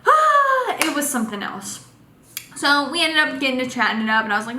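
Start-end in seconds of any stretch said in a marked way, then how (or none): 0.1-0.8 loud, high-pitched overwhelmed gasp; high starstruck gasp. Retold or imitated. Imitates her overwhelmed reaction.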